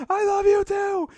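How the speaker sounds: very high, slow, drawn-out declaration; hammed-up tearful-movie reply, stretched out high; mock emotional reply. Second character answers in standoff, mock overwrought.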